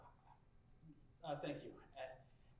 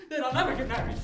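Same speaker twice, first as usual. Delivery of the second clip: louder, higher, stress on never; anxious exclamation; 6 semitones higher, 12 dB louder, faster than usual. Acted. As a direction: blurt it out as if to steel yourself. Anxious suitor talking himself up.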